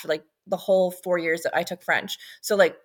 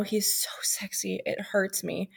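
whispered aside; 8 dB quieter, slower than usual. Real, not acted. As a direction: whisper it like a secret. Whispered aside about crush.

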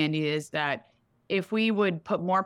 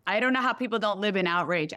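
ordinary talk

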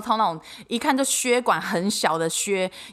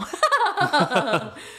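short laugh; 6 semitones higher than usual. Real, not acted. Laughs at own joke.

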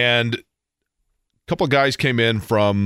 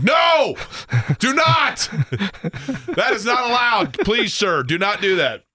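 retold alarmed yell; 7 semitones higher, slower than usual. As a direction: shout him off the cigarette in alarm. Stops Irish guy lighting cigarette.

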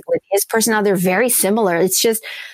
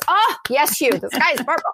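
higher, gushing agreement with Hugh; mock adoring fan; 7 semitones higher than usual. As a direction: gush like an audience hanging on every word. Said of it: "rapturous applause".

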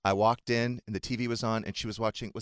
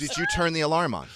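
mock nagging spouse; 9 semitones higher, 5 dB louder than usual. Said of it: "That's never gonna happen".

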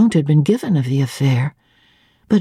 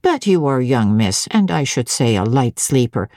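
low, deliberate servant's voice with meaning behind it; marked, significant tone; 6 semitones lower than usual. A narrator's reading. Grace Poole probes Jane, low with a pointed, significant weight. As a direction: lean on it, feigning indifference. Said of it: "in a marked and significant tone".

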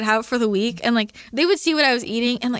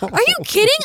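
high, loud indignant outburst; incredulous, raised-pitch protest; exasperated retort; 12 semitones higher, 4 dB louder than usual. Retold or imitated. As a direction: fire back in exasperated disbelief. Retold argument with dad.

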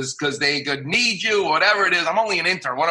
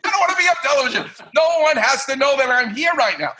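panicked protest; 8 semitones higher, faster than usual. Retold or imitated. Panicked about being on TV.

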